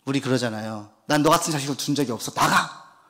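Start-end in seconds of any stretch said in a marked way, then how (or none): 1.0-3.0 fast scolding parent voice; mock angry parent. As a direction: snap it out like an angry parent throwing a child out. Parent scolding child, acted.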